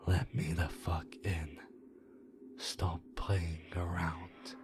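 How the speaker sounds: a hint of an uncanny, broken-rhythm voice; halting creature voice. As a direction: mimic a thing faking human speech, word by word. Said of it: "in this halting, weirdly toned voice".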